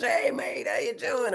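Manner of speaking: high, imitated English greeting; mock English accent. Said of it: "I'm more Mary Poppins in that".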